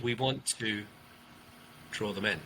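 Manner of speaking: low and soft with a long pause. Shown as a demonstration. Coach contrasting with the excited delivery, hushed and paused. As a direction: drop the voice and draw listeners in. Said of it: "we speak quieter".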